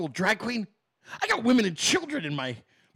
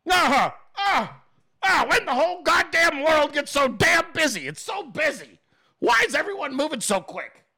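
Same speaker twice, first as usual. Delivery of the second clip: put-on grumpy old man; 6 semitones higher than usual. Imitating Bill as a confused old man, cranky shout. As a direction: yell at the busy world like a grandpa.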